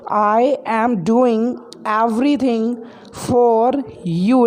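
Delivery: slow, word by word for learners; slow repeat. Shown as a demonstration. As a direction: spell it out slowly so students can follow. Teacher repeats sentence slowly for learners.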